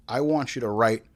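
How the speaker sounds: normal talking voice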